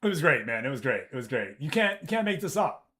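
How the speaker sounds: ordinary talk, nothing marked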